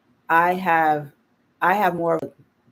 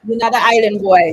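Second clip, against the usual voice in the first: shouted jab, raised and punchy; loud heckle; 7 semitones higher, 9 dB louder than usual. Real, not acted. Host shouting at [name]'s clip, loud mocking jab. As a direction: call it out loud at the screen.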